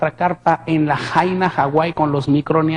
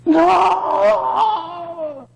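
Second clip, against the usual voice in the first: grito agudo y quebrado de angustia; grito desesperado; 13 semitones higher than usual. Retold or imitated. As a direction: grita "no" con el alma rota. (le grita al Señor desesperado)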